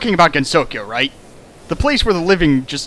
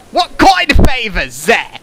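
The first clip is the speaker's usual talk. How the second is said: [name] in a put-on Cockney accent, higher, louder and slower; 7 semitones higher, 6 dB louder, slower than usual. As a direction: ask it like a cheeky Cockney girl. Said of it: "she's cockney all of a sudden".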